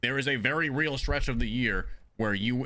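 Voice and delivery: ordinary talk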